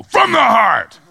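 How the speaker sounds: loud demanding parent. Retold or imitated. Parent insisting the child apologize, loud demand. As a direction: bark it like a fed-up parent. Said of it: "And they go".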